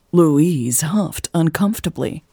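usual speaking voice

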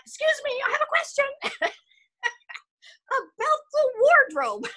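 very high, laughing little question; squeaky mock alarm. Actress to director about a revealing script, squeaky mock alarm. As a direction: pipe up in comic alarm, breaking into laughter. Acted shocked question at audition.

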